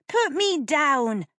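put-on high, loud, slowed child's shriek. A narrator's reading. Janet held by the Earl, high loud shriek. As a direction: shriek it while struggling in his arms. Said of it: "shrieked Janet".

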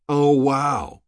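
high, loud mock amazement; sneering. A narrator's reading. Rourke scoffs at Havelock's high-level backing, sneering. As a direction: feign being wowed, with a sneer. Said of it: "Rourke sneered".

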